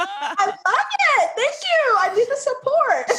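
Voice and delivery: high, slow, syrupy thank-you; gushing like a touched little kid; mock sweet. To her mom's proud DM, high, slow and mock-sweet.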